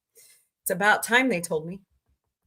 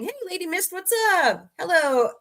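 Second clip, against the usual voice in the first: high, sing-song hello to a viewer; bright greeting; 8 semitones higher than usual. Real, not acted. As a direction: lift the voice high to greet her.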